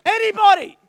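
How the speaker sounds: loud high call to the sky; loud call into empty air. Acted. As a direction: shout up, waiting for an answer. Calling out to God.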